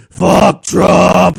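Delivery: possessed cyclist yelling; imitated yell. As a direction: bark it out like the wild guy on the bike. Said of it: "he just started yelling".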